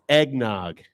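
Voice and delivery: ordinary talk, nothing marked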